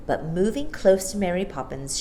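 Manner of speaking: ordinary delivery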